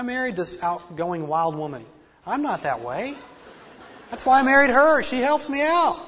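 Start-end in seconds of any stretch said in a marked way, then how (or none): 4.1-6.0 louder and higher, lively; animated. Real, not acted. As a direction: lift the voice with energy. Animated about outgoing wife.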